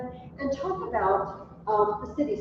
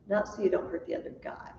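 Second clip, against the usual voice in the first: whispered aside; 3 semitones lower, 7 dB quieter, faster than usual. Real, not acted. Teacher's quiet aside joke.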